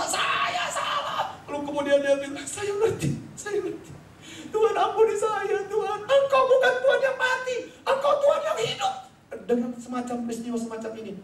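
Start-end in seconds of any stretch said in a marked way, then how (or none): 0.0-1.4 very high, very slow cried-out confession; wrenched out in a long cry; anguished realization. Retold or imitated. Luther realizes his error. 2.4-8.9 fervent prayer. Retold or imitated. Luther prays in repentance.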